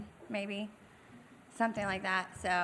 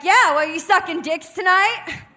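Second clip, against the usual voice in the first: high, loud retort in another voice; furious comeback; 9 semitones higher, 11 dB louder than usual. Retold or imitated. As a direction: snap back loud and high, losing it. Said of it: "Faith straight fucking loses it".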